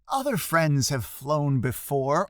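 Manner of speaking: ordinary delivery, nothing marked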